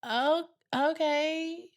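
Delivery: slow, high unimpressed "Oh, okay"; sarcastic. Retold or imitated. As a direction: drag it out, mock unbothered. Sarcastic reaction to troll.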